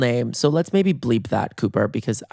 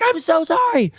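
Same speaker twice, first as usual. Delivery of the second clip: high-pitched squealed apology; imitated shriek of apology; mock screamed apology. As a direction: wail it high, without full volume. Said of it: "screaming at the top of our lungs".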